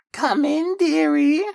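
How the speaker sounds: put-on a wolf faking a sweet old lady; very high mock granny voice; fake granny falsetto.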